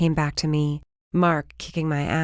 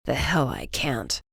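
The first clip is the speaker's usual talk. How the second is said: low whispered snap; hushed furious retort; snapped; 4 semitones lower, 4 dB quieter than usual. A narrator's reading. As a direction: bite it back in a hushed fury. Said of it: "he snapped".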